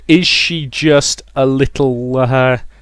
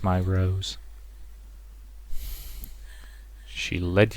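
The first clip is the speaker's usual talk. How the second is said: quiet menace from a possessive mother; 5 dB quieter than usual. Acted. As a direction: croon it softly, drawing out key words.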